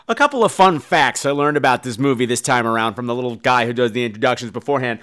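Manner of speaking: ordinary delivery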